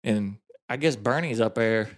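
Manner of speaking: ordinary talk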